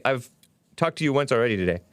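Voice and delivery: ordinary talk, nothing marked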